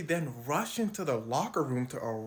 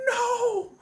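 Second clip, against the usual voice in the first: panicked denial; 5 dB louder than usual. Retold or imitated. Scared fan denying.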